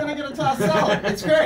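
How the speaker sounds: high and loud through laughter; laughing punchline. Real, not acted. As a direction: crack up at your own joke.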